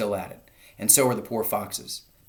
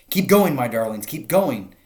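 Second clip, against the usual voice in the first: urgent breathless encouragement; panting; 4 semitones higher, 8 dB louder than usual. A narrator's reading. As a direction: urge them on, out of breath. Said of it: "panted Mister Fox".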